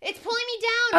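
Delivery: high, desperate sinking cry; being dragged under, panicking; panicked. Pretend. Sinking in pool.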